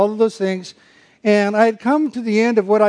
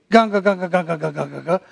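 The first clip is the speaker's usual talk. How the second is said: grumbling noise; 5 semitones lower, much faster than usual. Shown as a demonstration. Preacher mocks grumbling instead of thanks, mutter. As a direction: grumble like a complainer. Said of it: "Not in everything".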